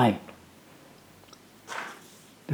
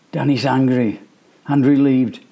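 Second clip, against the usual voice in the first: usual speaking voice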